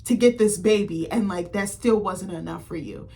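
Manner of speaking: ordinary talk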